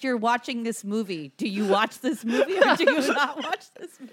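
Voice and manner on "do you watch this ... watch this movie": much higher and louder, laughing at the metaphor; mocking laugh. Teasing co-host about his metaphor, laughing loudly. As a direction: tease him while cracking up.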